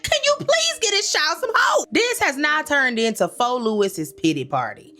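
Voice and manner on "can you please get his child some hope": pleading in a much higher voice; exasperated plea. Retold or imitated. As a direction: beg the screen in a high, exasperated voice.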